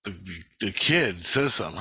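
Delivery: ordinary delivery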